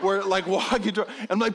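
plain reading voice

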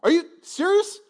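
high, loud indignant protest; an offended servant arguing back at God; arrogant. Pretend. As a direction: scoff like you're owed respect. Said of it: "real arrogant about it".

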